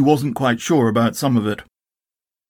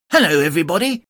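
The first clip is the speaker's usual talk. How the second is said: a bit higher, bouncy greeting; cheerful Tigger; 5 semitones higher, faster than usual. Acted. Tigger greets everybody cheerfully. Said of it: "said Tigger cheerfully".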